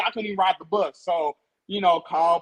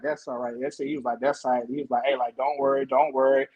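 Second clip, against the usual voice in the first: much lower, calm reassurance; reassuring dad; 10 semitones lower than usual. Retold or imitated. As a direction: soothe the scared boy, deep and easy.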